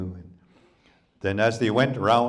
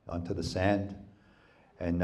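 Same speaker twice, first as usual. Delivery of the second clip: ordinary delivery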